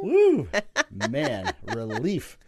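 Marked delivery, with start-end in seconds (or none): 0.0-0.5 high loud woo; excited whoop. Real, not acted. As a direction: whoop with relief. Celebrates correct math.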